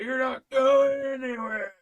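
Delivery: high, loud, drawn-out mock holler; imitated drunk uncle's yell. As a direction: holler it slowly, blocking the way. Uncle blocking the car, acted.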